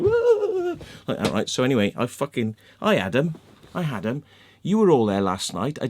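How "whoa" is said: a dog's high yelp, lightly voiced; a hint of a dog yelping. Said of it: "the dog was like".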